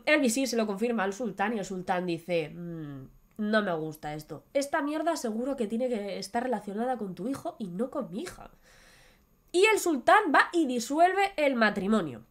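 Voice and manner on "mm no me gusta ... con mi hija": low, soft suspicious grumble; lightly voiced grumpy sultan; grumbling sultan. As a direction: mutter it darkly like a suspicious king.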